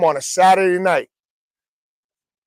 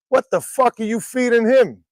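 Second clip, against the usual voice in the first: raised, higher-pitched incredulous question; mock disbelief; 6 semitones higher than usual. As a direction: act out a baffled demand. Astonished greeting acted out in story.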